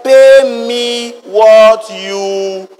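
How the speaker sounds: very slow, loud demand for payment; menacing demand. Acted. Servant choking his debtor, slow menacing demand. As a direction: drag it out as a threat.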